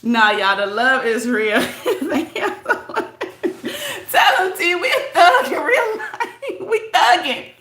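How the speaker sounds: high, breathless laughter under every word; real giggling from being touched by the love; laughing. Real, not acted. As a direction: laugh through it, half crying. Laughing about being a gangsta crybaby.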